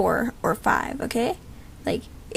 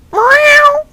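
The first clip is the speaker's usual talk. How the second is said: much higher, much louder meow; cat meow; 12 dB louder than usual. Acted. Girl voicing the cat character's loud high meow. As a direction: belt out a cartoon cat's meow. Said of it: "And then he says".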